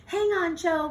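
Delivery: loud, high urgent cry; urgent call. Acted. Melia calling up to Jo stuck on the branch, urgent and loud. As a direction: call up to reassure her fast.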